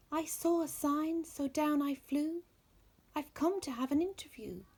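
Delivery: high, chirpy little bird; small bird voice. Acted. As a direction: pitch it up like a tiny songbird. Tiny bird speaks at Bear's feet.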